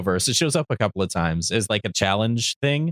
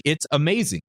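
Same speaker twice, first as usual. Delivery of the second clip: ordinary delivery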